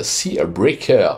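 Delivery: Sea Breaker dit lentement avec un accent anglais appuyé. Shown as a demonstration. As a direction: prononce Sea Breaker à l'anglaise, en détachant, fier de son accent anglais. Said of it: "Vous avez vu mon accent". (nom du cheval articulé lentement avec un accent anglais exagéré)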